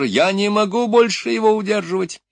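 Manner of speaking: high, loud strained urgency; desperate shout. A narrator's reading. Доктор с трудом удерживает идиота. (отчаянный высокий крик)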